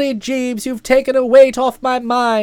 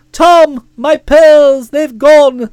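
hammed-up wail of a pretend victim, louder; mock tearful; 7 dB louder, much slower than usual. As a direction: fake distress over stolen pearls. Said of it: "in floods of tears and say".

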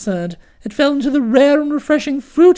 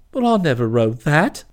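much lower, softer, a shocked gasp; gasped denial; 11 semitones lower, 3 dB quieter than usual. A narrator's reading. Accused critic gasps his denial, shocked and low. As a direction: caught off guard, gasping it out low. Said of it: "he gasped".

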